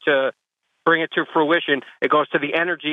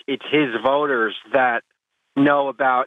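normal talking voice

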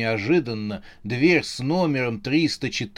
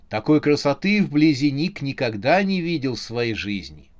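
plain reading voice

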